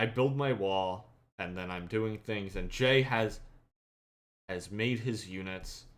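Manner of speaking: ordinary talk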